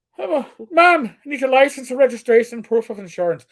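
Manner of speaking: a light Irish brogue, opening high; playful Irish cop at a car stop; hammed-up Irish brogue. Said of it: "slip in with my voice".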